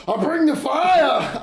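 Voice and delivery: high, drawn-out shout; mock angry. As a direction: put on a big angry yell, slowly. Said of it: "I also get real angry".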